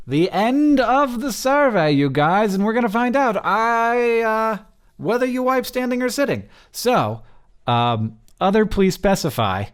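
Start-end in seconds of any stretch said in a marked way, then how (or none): 0.1-6.4 playful excitement. Real, not acted. Teasing the toilet question.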